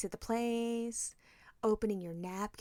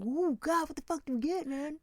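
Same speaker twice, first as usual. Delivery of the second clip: husband voiced, high; 6 semitones higher than usual. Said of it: "him going".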